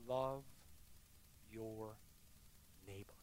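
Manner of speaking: slow, soft and spaced; gentle Jesus. Retold or imitated. Jesus contrasted with the strong man, slow and soft. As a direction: say it softly, word by word. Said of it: "That's not how he talks".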